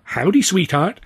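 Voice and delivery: lilting friendly showgirl greeting; sing-song howdy. Acted. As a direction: sing it out cheerily. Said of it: "They just sang out".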